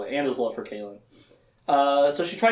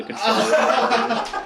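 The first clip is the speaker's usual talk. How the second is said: laughing; 7 semitones higher than usual. Real, not acted. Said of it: "laugh heard".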